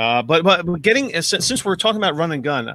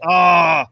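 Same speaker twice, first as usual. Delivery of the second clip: frustrated yell; 2 semitones higher, 5 dB louder than usual. Retold or imitated. Panicking over a bad draft pick.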